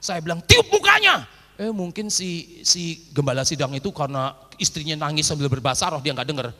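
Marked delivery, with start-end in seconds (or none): 0.4-1.2 high, loud call of "Tiup mukanya"; loud instruction. Retold or imitated. Preacher telling the husband on the phone, loud and high.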